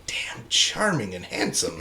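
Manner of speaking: a breathy aside whisper. Real, not acted. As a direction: whisper it like a secret crush.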